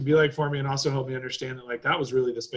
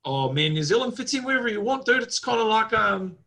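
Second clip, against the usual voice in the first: put-on high, breezy Kiwi accent; New Zealand accent; 10 semitones higher, 5 dB louder than usual. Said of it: "It's a solid New Zealand accent".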